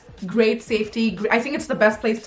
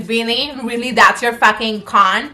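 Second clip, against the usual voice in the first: mock disbelief; 2 semitones higher, 6 dB louder, slower than usual. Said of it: "you're like".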